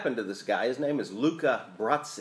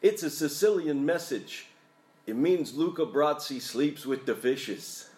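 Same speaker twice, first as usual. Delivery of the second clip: put-on lightly done New York tough-guy accent. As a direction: deliver the line like a New York mobster. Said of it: "If I do my best New York accent".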